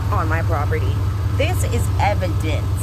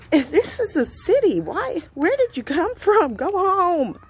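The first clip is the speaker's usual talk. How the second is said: exasperated scolding; 9 semitones higher, slower than usual. Real, not acted.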